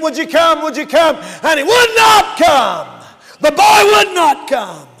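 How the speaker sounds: high, driving, relentless sermon voice; full-force preaching. Real, not acted. Preacher telling of boy refusing to come, preaching forcefully. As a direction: preach it in a building cry.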